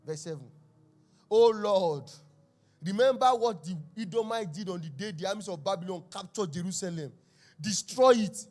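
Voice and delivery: loud, ringing scripture reading; psalm read with force. Acted. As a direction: proclaim the verses to the congregation. Reads the psalm aloud.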